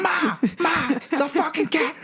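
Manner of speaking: put-on very high panicked yell, New York accent; screaming New York accent. Man in the video yells to his mom, New York accent. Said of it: "he's, like, screaming about it".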